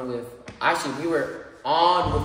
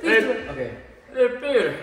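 cartoon dad voice, higher and far from his own; Joe Swanson voice; 6 semitones higher than usual. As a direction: do the Family Guy wheelchair guy. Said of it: "It's the guy from Family Guy".

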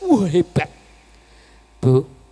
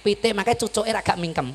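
ordinary talk

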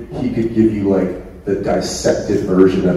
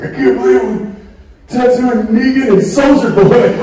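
amazed tattoo artist; 13 semitones higher, 3 dB louder than usual. Retold or imitated. Tattoo artist marvels, laughing.

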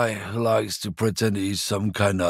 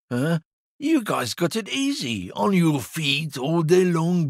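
high whiny rant; 8 semitones higher than usual. Acted. Lorry driver ranting, voice pitched up.

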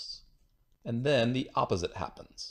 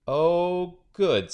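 higher, louder pleased exclaim; delighted; 8 semitones higher, 7 dB louder than usual. A narrator's reading. Boy delighted to see the opposite again, loud glad cry. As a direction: greet the opposite with glee.

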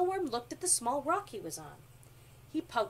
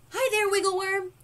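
high, very loud hello; lively fish character calling out; shouted greeting; 9 semitones higher, 10 dB louder than usual. A narrator's reading. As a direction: holler hello to a friend. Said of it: "he shouted".

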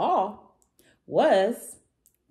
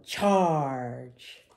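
low, drawn-out storybook bellow; dinosaur's deep rallying call; bellowed battle cry; 4 semitones lower than usual. A narrator's reading. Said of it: "and bellowed".

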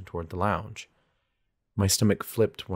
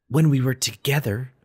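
higher, louder irritated retort; snapping; 5 semitones higher, 6 dB louder than usual. A narrator's reading. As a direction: cut her off with a raised voice. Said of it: "I snapped".

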